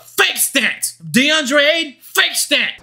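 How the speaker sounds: ordinary talk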